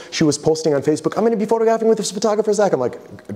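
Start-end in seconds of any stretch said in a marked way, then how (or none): 1.2-2.7 much higher and fast, gushing; imitating her excited post. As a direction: squeal it out like a thrilled fan posting online. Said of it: "She was so excited".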